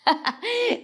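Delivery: a high little giggle in the chant; a cheeky giggle after deferrerê; little laugh. Shown as a demonstration. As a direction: drop in a short giggle as part of the rhyme. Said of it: "Tem até uma risadinha".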